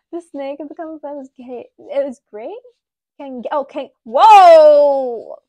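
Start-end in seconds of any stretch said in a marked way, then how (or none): 4.0-5.4 loud startled whoa. Real, not acted. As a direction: jump back, caught off guard. Startled by snake.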